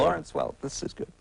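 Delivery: near-whispered mock approval; hushed put-on contented viewer; imagined TV viewer. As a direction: whisper it to the television, playfully. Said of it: "the image of you sitting before the T V set going".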